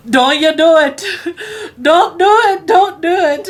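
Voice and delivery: high, loud desperate plea; panicked pleading. Retold or imitated. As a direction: beg like you're about to throw up yourself. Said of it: "in a moment of sheer panic".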